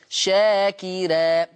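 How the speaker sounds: شاكرا said very slowly, the ر light and thin after the kasra; drawn-out recitation. Shown as a demonstration. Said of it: "فتصير".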